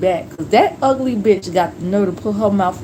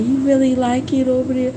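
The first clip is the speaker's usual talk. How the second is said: higher-pitched mimicking question; mock nosy coworker; 4 semitones higher, faster than usual. Nosy coworkers quizzing husband about new job, mocking imitation.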